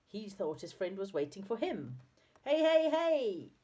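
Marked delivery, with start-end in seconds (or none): 2.4-3.5 high, loud, drawn-out call; excited yell. A narrator's reading. Saucepan Man spotting his old friend below, yelling excitedly. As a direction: yell down to a friend, slowly stretched. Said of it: "he yelled".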